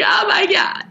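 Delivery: high, breath-heavy "devastated reader" reaction; mock overwhelmed gasp. Reader retelling her reaction to Jonathan's line, mock overwhelmed.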